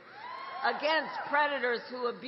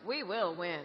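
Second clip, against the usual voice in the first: booming rally cadence, building; rally speech; 6 dB louder than usual. Real, not acted. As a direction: rouse the crowd with each line. Rally crowd, repeated chant lines.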